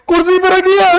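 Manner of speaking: put-on crying child. Teacher imitates a crying child answering where the key is, high whimper. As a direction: imitate a sobbing kid blurting out the answer. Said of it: "रोते रोते क्या बोलोगे".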